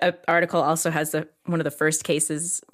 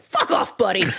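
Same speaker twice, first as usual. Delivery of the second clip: mock outrage. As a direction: throw it back in exaggerated indignation. Acted comeback to calm doctor.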